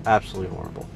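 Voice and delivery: usual speaking voice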